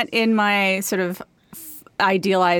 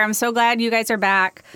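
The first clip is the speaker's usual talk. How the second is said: ordinary talk, nothing marked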